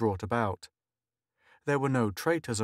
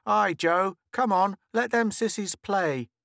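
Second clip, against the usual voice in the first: put-on calling boy; 10 semitones higher, 4 dB louder than usual.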